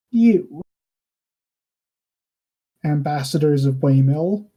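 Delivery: halting, measured delivery with pauses; lightly voiced ruler weighing each word; restrained ruler. Ruler on his throne addresses the ambassadors of Waymill, restrained. Game master speaks as ruler.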